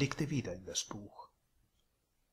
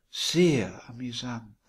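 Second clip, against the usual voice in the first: sweet landlady; 8 dB louder, slower than usual. A narrator's reading. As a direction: coo it like a doting hostess. Landlady voiced by narrator.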